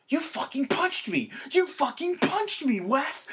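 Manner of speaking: very high, indignant screech; mock outraged yell. Punched friend protesting to his attacker, outraged high yell.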